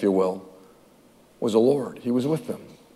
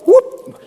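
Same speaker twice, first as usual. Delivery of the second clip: playful whoop. Acted. Playful exclamation.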